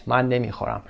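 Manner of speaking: normal talking voice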